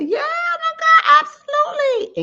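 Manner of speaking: high, gushing put-on delight; fake cheerful gush. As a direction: squeal it like you're thrilled, all fake smile. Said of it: "still smile and say".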